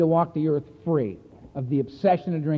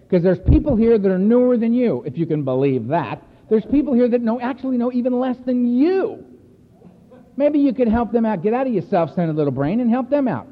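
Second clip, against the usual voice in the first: louder, pushy lecture in the sponsor's voice; sponsor's scolding; 6 semitones higher, 5 dB louder than usual. Retold or imitated. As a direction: bark it like a blunt sponsor telling him off. Sponsor Donald scolding him, retold.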